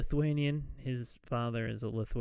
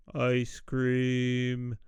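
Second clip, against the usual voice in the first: slow, sustained "ice cream", nearly sung; slower; 2 semitones lower, 4 dB louder than usual. Retold or imitated. School board man obeying Harold, slow drawn-out words. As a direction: hold each vowel of "ice cream" evenly. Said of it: "Say it slower".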